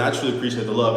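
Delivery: ordinary talk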